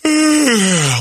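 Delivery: high, loud fake yawning sigh; loud mock yawn sigh. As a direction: sigh like someone falling asleep. Acted sleepy sigh.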